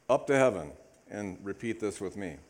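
usual speaking voice

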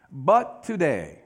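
but today drawn out slowly; slow emphatic. Acted. Preacher slowing to stress today to the congregation. Sermon contrast point.

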